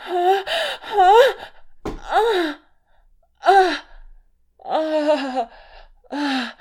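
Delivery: loud, high pleasure cries; climax moans. Acted. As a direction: cry out at the peak of climax.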